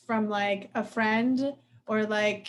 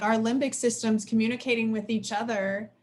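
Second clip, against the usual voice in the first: usual speaking voice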